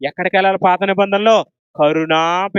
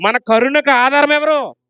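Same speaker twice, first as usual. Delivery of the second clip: higher and louder, ringing call; raised voice; 6 semitones higher, 3 dB louder than usual. Shown as a demonstration. Preacher urging the crowd to speak up, raised voice. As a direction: lift the voice to proclaim. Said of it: "గొంతెత్తి చెప్పాలి".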